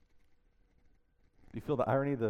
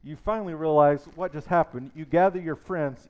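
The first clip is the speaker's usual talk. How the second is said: normal talking voice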